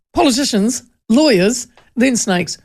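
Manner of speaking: normal talking voice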